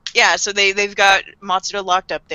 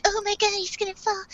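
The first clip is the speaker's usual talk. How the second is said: high worried gasp; 11 semitones higher, 9 dB quieter than usual. Retold or imitated. Girls gasping on balcony.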